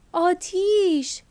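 sudden high, loud, drawn-out cry of alarm; shouted warning. Acted. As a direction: yell fire to warn everyone, stretching it out. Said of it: "فریاد زد".

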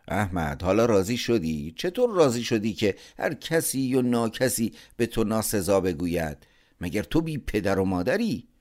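a father ashamed and angry, pushing each question harder; angry scolding. A narrator's reading. Father scolds shamed son.

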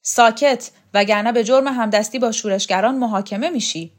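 shouted threat. A narrator's reading. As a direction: bark an order to shut up. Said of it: "حدادیان داد کشید".

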